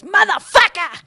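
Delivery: loud, drawn-out mock New York yell; imitated neighbor's curse. Retelling a neighbor swearing at the elevator, loud mock New York cursing. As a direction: bellow it like an irate New Yorker. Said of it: "and this guy goes".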